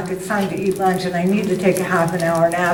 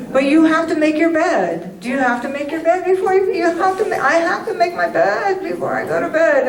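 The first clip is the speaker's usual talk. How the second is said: mock self-scolding; 9 semitones higher than usual. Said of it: "mock self-scolding".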